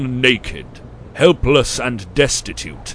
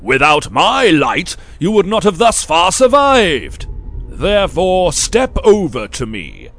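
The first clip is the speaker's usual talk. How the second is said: thundering elder; 7 semitones higher, 9 dB louder than usual. Acted. Elder booms at the boys that he is the sun. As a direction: proclaim yourself the sun.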